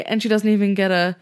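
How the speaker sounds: ordinary talk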